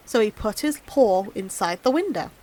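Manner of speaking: usual speaking voice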